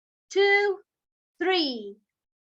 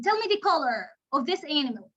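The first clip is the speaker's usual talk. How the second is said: usual speaking voice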